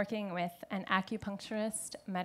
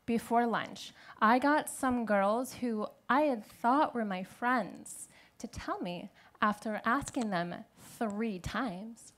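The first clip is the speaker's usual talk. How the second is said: usual speaking voice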